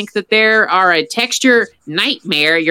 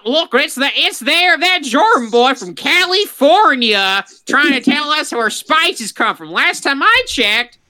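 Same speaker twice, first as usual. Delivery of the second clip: lightly put-on Southern accent, a bit higher; 4 semitones higher than usual. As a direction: play a Southern local mocking a Californian. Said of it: "put the accent on".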